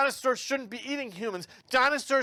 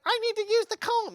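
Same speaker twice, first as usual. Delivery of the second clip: put-on high pleading kid voice, much higher; bossy little sister; 13 semitones higher than usual. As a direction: play a pushy little sister wanting the comb. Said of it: "And she's like".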